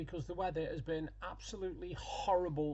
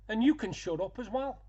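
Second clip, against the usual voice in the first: louder, higher mimicked rebuke; retold telling-off; 6 semitones higher, 7 dB louder than usual. Woman on scooter to a stranger, retold telling-off. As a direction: imitate someone telling a bystander to shut up, forcefully.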